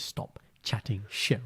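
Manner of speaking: husband imitating his wife hissing at him, whispered; whispered retort. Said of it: "Shindy says".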